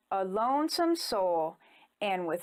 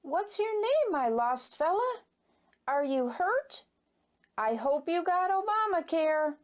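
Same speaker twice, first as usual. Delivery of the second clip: a lightly voiced, bright higher stranger; cheerful stranger; 5 semitones higher than usual. A narrator's reading. Magical baby clown greets lost Ingus, cheerful higher voice. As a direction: greet him like a bouncy clown child. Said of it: "asked the stranger with a glowing stare".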